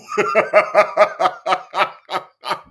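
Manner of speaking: long, high burst of laughter; triumphant laugh. Real, not acted. Reactor proved right about the accent, triumphant laugh. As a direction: laugh on and on, vindicated.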